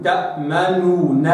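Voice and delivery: slow تأمنون, both noons separate; original form. Shown as a demonstration. Teacher showing the original form. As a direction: say تأمنون split into two noons. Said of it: "أصلها كده".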